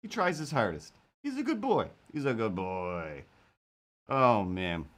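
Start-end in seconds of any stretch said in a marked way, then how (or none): 1.2-1.9 put-on high pitched "He's a good boy.". Shown as a demonstration. Two takes contrasting. 2.1-3.2 put-on low, soft "He's a good boy."; deep voice. Shown as a demonstration. Two takes contrasting.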